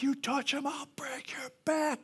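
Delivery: soft, strained protective menace; hushed acted warning, slowing at the end; whispered threat. Mike threatens the bully.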